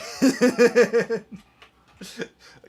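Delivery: high cackling burst of laughter; loud laugh. Real, not acted.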